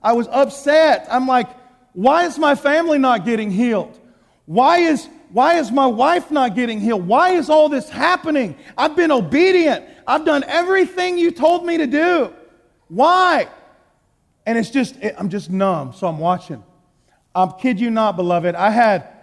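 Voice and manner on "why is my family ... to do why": higher, louder accusing questions to God; angry questioning. Retold or imitated. Preacher retelling his demands to God, raised and indignant. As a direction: demand answers, pushing higher with each Why. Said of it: "I was mad at God".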